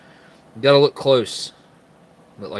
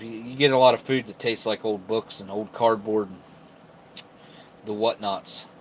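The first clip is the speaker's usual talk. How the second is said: ordinary talk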